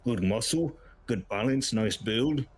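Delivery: orc appraising a recruit; put-on orc character voice; grizzled orc. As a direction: look over a recruit as a battle-weary orc. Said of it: "He sounds".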